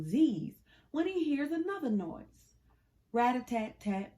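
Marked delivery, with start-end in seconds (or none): none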